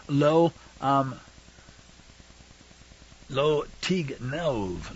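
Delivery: halting, drawn-out reading of a foreign phrase; careful sounding-out. Real, not acted. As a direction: sound out the Hebrew slowly and quietly. Sounding out the Hebrew commandment.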